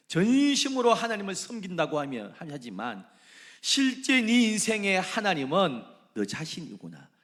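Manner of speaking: high, loud reproach; loud rebuke. Acted. The Lord rebuking Jehu, loud reproach. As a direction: call out his hypocrisy.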